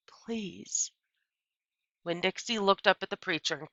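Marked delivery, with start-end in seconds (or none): 0.1-0.9 soft breathy please; whispered plea. Acted. Opal pleading with her father, whispered. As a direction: beg in a whisper.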